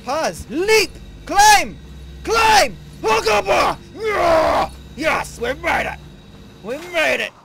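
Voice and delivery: loud high shouted commands; excited shouting. Real, not acted. Player shouting moves at his partner, then cheering loud.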